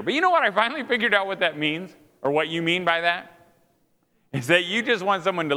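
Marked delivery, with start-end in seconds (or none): none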